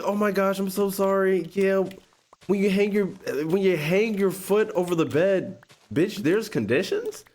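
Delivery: high mock girlfriend voice; mocking her voice. He mimics her excuse in a high mocking voice. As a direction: imitate her high and sarcastic. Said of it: "it was like".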